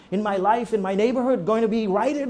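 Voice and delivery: ordinary talk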